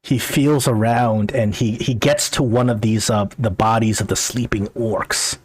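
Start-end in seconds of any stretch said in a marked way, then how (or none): none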